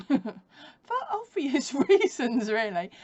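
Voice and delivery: loud, high, slowed by laughter; laughing. Real, not acted. Explaining why the dog is not allowed in, laughing. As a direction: laugh through the words.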